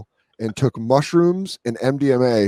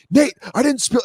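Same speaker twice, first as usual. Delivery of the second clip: high-pitched mock protest; 11 semitones higher than usual. Retold protest to drunk friend.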